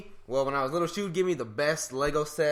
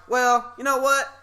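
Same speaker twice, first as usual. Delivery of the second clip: higher and louder defiant comeback; defiant retort; 7 semitones higher, 6 dB louder than usual. Retold or imitated. A teen snapping back at his mom over the gift, louder and higher. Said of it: "And I'm like".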